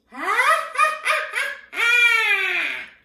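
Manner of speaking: high, loud witch's cackle. Acted. Voice teacher finding the witch, piercing cackle. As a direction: shriek with wicked staccato laughter. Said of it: "our witch".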